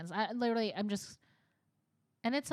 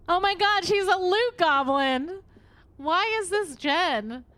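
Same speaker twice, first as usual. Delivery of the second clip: real loud, high-pitched amazement; excited outburst; 9 semitones higher, 9 dB louder, slower than usual. Real, not acted. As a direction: cry out in drawn-out, high disbelief. Delighted reaction to game loot.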